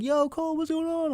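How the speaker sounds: high-pitched, casual friend voice; high mock friendly greeting; friends calling out. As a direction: imitate buddies calling after someone. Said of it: "My friends are like".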